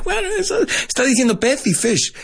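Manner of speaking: lightly squeaky retold reply; high mock voice. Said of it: "I'm like".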